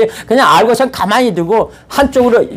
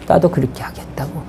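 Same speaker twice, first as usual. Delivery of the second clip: whisper; 2 semitones lower, 6 dB quieter than usual. Retold or imitated. Daughter agreeing quietly in retelling.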